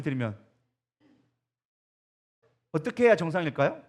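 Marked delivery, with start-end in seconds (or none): none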